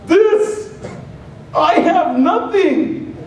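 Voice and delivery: high, slow complaining plea; pleading whine. Acted. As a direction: insist to God that you have nothing. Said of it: "turning to God saying".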